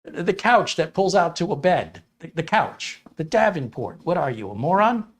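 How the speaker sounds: high, slowed, impatient explanation; mock indignation, rising to a question; exasperated local. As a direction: spell it out as if to a fool. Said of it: "within a certain region speak a certain way".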